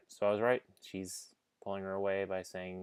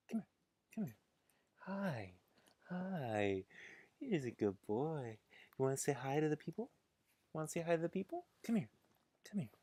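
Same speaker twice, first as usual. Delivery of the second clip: higher, sing-song pet talk; 7 semitones higher than usual. Real, not acted. Streamer coaxing the corgi to greet viewers, baby talk. Talking sweetly to the dog.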